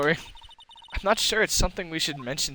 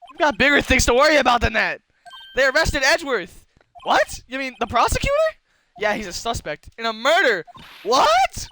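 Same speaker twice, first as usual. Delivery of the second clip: put-on high, loud frantic girl voice; alarmed Maya; 10 semitones higher, 6 dB louder than usual. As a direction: voice the panicked assistant.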